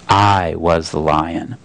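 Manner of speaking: a great calm voice weighing each word; Aslan's voice. Acted. The lion corrects Shasta, low and slow. The Voice reveals itself.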